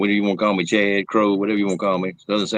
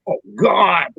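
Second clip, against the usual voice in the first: loud, high hammed-up cry; mock agony; 11 semitones higher, 4 dB louder than usual. Ten seconds into a gas attack, high mock wail. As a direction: cry out in comic horror. Acts out suffering through the gas.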